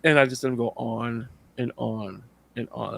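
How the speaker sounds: ordinary delivery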